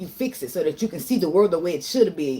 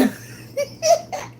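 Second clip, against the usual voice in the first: short airy laugh up high; high breathy laugh. Real, not acted. Laughing at herself to viewers, high airy laugh. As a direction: laugh off the hurt. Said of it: "I'm laughing at myself, y'all".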